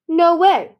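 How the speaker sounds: sharp, loud refusal; emphatic refusal. A narrator's reading. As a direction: blurt it out in firm protest. Character refusing the rock outright.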